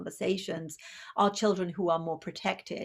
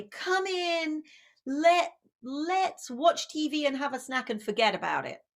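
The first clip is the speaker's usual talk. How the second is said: higher, coaxing and sweet; a parent coaxing a sad child into a treat; light sing-song comfort; cooing parent; 6 semitones higher than usual. Retold or imitated. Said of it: "another kind of response".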